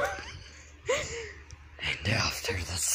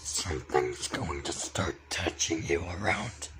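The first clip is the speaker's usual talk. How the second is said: low eerie whisper; creepy whisper; 5 semitones lower, 6 dB quieter than usual. Acted. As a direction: whisper it menacingly. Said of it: "Doing that voice".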